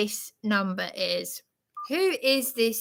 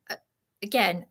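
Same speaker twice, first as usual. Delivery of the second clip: normal talking voice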